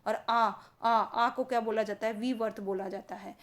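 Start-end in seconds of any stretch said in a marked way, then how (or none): 0.1-0.9 आ, आ with mouth wide open; open vowel demo. Shown as a demonstration. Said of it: "मुख सबसे अधिक खुलता है".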